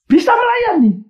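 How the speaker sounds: cracking high cry on melayani; emotional peak. Real, not acted. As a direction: let the voice break upward in earnest.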